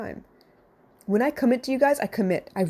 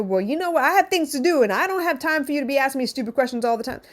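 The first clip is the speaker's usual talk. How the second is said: higher, snappy, dismissive put-on voice; mock irritated brush-off; 6 semitones higher than usual. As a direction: play the busy parent shutting her down. Example of the wrong reply.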